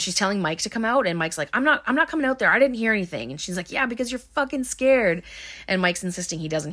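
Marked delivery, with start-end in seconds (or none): 1.5-3.3 higher, mock-defensive refusal; imitating a defensive refusal; retold protest. Retold refusal to leave tent. 3.7-5.2 high-pitched mock accusation; imitating a scornful comeback; retold taunt. Retold taunt at frightened friend.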